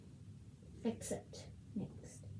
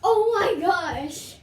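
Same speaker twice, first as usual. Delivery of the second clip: excited; 4 semitones higher, 6 dB louder, slower than usual. Real, not acted. Excited again about the cat app.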